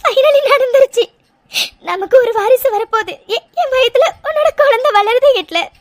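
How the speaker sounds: overwhelmed, weepy relief; tearful joy. Acted. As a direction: voice full of happy tears. Weeping with joy to husband.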